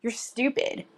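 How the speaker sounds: ordinary talk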